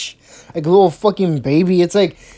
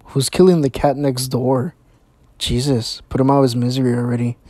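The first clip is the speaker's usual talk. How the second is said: lower, grumbling annoyed neighbor; 7 semitones lower than usual. Retold or imitated. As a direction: complain about the noise next door. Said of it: "I'd be like".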